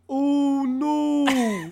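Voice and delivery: high, feeble little dragon voice; a pathetic squeak from a huge ugly dragon; tiny wheezy monster going oh no; squeaky dragon. Acted.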